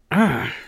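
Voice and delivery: loud, high yelp "Ah!"; a sudden pained exclamation; sharp cry of pain. A narrator's reading. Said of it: "Aki exclaimed".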